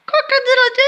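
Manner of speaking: loud, high mock cock-a-doodle-doo; playful rooster call in a kids' lesson; rooster crow.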